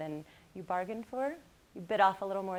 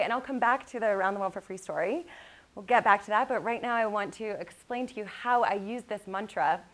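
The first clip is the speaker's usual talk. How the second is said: normal talking voice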